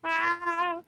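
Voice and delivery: squeaky breathless laugh; high giggle. Real, not acted. Gamer cracking up at Guzzlord, high soft giggle.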